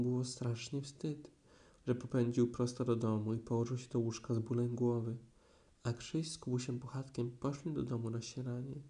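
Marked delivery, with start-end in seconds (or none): none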